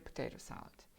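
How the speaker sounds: a hushed little aside; whispered aside. Shown as a demonstration. Teacher whispering a word while pointing at the picture. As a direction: breathe "potato salad" without voice. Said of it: "whispered aside".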